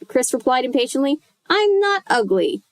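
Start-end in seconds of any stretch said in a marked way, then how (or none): 1.5-2.6 high squeaky puppet voice; squeaky dummy voice. A narrator's reading. Slappy protesting to Chris in a high squeak. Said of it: "in Lindy's squeaky voice".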